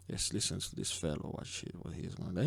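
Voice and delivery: a low unvoiced mutter to yourself; whispered aside. Real, not acted. Host mutters to himself before playing the news clip, whispering. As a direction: whisper it under your breath.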